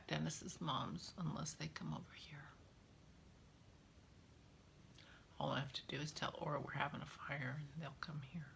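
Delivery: fast hushed whisper. Real, not acted. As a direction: whisper it quickly aside. Aside about visitors, whispered.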